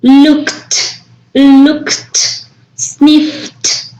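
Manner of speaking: "looked" and "sniffed" ending in a crisp T, said as "lookt", "snifft". Shown as a demonstration. Teacher modelling the voiceless endings for students, carefully. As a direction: end "looked" in a clean T sound. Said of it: "va a pronunciar ese ed con T".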